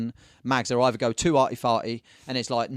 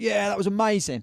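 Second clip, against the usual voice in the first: acted enthusiasm; 9 semitones higher than usual. Acts the encouraging reply to beginner.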